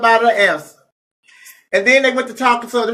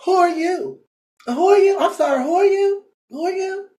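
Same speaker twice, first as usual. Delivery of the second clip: high, snooty mock of a teen throwing shade; shady mock question; 8 semitones higher, 3 dB quieter than usual. Retelling how the teen threw shade at him, snooty high mock. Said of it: "throwing out shade".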